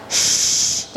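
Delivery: sh hissed with spreading air; sh breathed out wide; shin demo. Shown as a demonstration. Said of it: "kita akan merasa angin".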